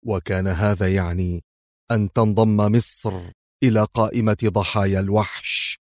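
usual speaking voice

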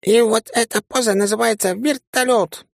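high, strange put-on alien voice, leering; a sleazy little alien announcing his trick; pervy green alien voice. A narrator's reading. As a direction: play the green creature showing off a sex position. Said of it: "узнаваемый голос зелёного извращенца".

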